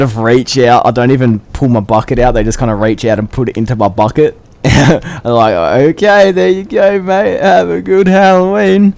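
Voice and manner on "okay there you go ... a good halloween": higher, louder friendly neighbour voice; imitating a householder. Householder wishing the masked kid happy Halloween, higher and bright. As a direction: hand over the lolly cheerfully, pitched up.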